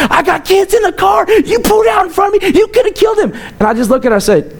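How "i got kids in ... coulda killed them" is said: hammed-up screech of an angry mother; mock angry lady shout. Retelling to the congregation, imitating the furious lady driver's high shout.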